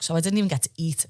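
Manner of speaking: ordinary delivery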